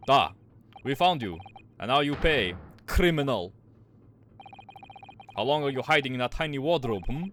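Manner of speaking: forceful, hammed-up Russian accent; terrible Russian accent. Sailor confronting a captured stowaway, terrible Russian accent. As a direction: interrogate in a bad Russian accent. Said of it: "all getting this fucking terrible Russian accent".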